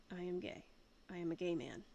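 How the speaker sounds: usual speaking voice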